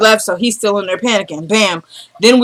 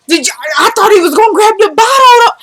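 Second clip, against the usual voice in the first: high, rushed astonishment; shocked disbelief; 12 semitones higher, 4 dB louder than usual. Real, not acted. Host recalls the bottle moment to viewers, high and astonished. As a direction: gasp it out, still in shock.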